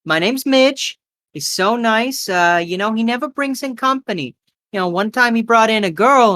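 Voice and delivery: high woman's voice, chattering away; high-pitched, gossipy diner waitress; chatty waitress. Acted. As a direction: gush to a newcomer in a high voice. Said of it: "She goes".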